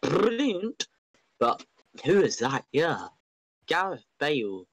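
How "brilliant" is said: Brilliant pitched high and falling; excited repeat. Real, not acted. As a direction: Brilliant sung out high, dropping at the end. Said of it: "I'll say it again".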